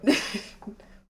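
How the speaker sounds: breathy high laugh; a quick high laugh; high giggle. Real, not acted. Laughing at own sadness.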